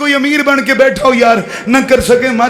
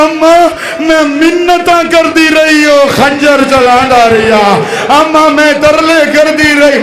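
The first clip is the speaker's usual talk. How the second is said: crying lament; 4 semitones higher than usual. A narrator's reading. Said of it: "बीबी ने रो के कहा".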